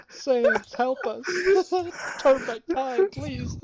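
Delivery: high, loud, mock desperate; hammed-up plea. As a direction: beg in a hammy wail. Pleading to game character.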